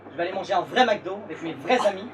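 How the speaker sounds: from firm to loud defiant shout; building to a shout. Acted. Defiant closing rant.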